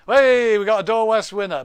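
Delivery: loud, high burst of excitement; delighted winner announcement, loud and high; excited shout. Real, not acted. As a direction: call out the winner with a cheer.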